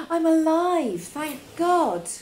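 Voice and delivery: high, loud burst of relief; loud breathless thanks to God; relieved exclamation. Acted. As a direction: cry out that you're alive. Relief after near miss.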